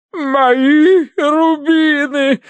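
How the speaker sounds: high, loud, drawn-out lament; wailing sob. A narrator's reading. Wife tells the colonel her rubies are gone, wailing. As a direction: wail hysterically over lost treasure. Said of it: "прорыдала она полковнику".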